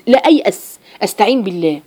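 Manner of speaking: ordinary talk, nothing marked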